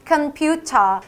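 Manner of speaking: British "computer" with crisp T, ending in AH; British pronunciation. Shown as a demonstration. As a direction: say "computer" British, final R dropped. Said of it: "R 발음도 날려야 되기 때문에". ("computer" 영국식, 끝 알 발음 빼고)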